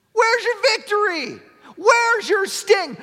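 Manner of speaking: high, loud taunting shout at death; trash talk. Acted. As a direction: get in death's face and shout it out. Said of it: "Paul's trash-talking death".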